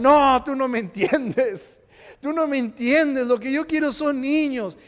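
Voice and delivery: acted wail of a misunderstood wife; upset protest. Acts Ana's upset protest to husband.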